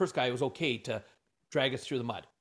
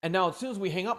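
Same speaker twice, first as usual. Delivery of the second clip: ordinary talk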